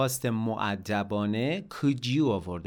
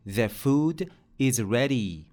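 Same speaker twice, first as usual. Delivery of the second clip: very slow model repeat; much slower than usual. Shown as a demonstration.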